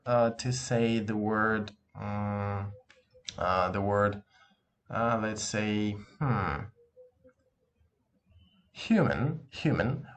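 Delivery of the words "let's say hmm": normal talking voice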